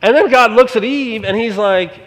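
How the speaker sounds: ordinary talk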